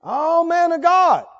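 high, drawn-out mock reverence; sarcastic. Shown as a demonstration. Captain mocking Elijah the prophet, sarcastic. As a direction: stretch the title out to mock it.

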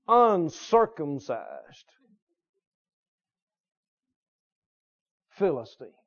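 slow, drawn-out contempt; derision. Acted. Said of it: "I believe he said it with derision".

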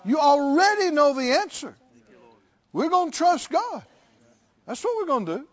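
loud, high repeated challenge with laughs; preacher pressing the crowd, half laughing; hammering preaching. Acted. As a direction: drive the question home again and again. Preaching climax.

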